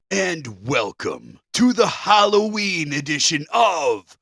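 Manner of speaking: slow spooky villain greeting; booming demon lord. Acted. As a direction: loom over them, slow and menacing. Spooky Halloween host greeting.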